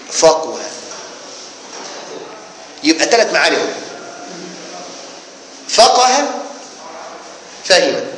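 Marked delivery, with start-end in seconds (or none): 5.7-6.3 فقه announced high as "faqaha", with a after the qaf; middle vowel a. Shown as a demonstration. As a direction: call out فقه with an open middle a. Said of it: "بفتح العين".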